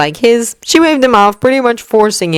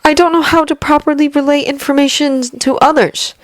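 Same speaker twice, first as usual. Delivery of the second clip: put-on higher, earnest young man's voice; young hero voice; 8 semitones higher than usual. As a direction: voice him tense but polite. Izuku voiced across long speech.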